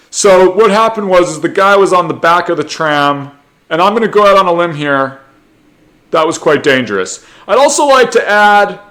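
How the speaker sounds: loud, high-pitched self-important spokesperson; mock spokesperson. Tram representative commenting to press, pompous mock statement. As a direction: announce the obvious with mock importance, voice pushed up.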